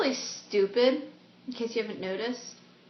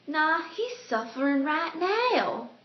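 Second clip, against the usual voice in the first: put-on slow Southern accent, pitched higher; put-on Southern drawl, higher voice; Daisy; 6 semitones higher, slower than usual. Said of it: "gonna have a southern accent".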